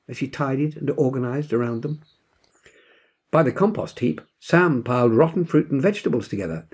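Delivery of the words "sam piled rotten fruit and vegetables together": plain reading voice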